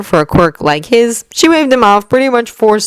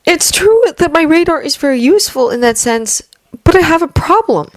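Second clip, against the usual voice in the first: put-on higher, earnest young man's voice; young hero voice; 12 semitones higher than usual. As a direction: voice him tense but polite. Izuku speaks in story.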